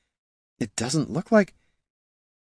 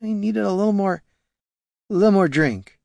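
put-on high, slurred drunk elf; drunken slurring; 11 semitones higher than usual. A narrator's reading. Hungover ranger explaining she needed more drink, slurring drunkenly. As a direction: slur it like you're still drunk. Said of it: "slurred Catha".

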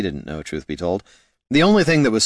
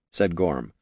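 ordinary delivery, nothing marked

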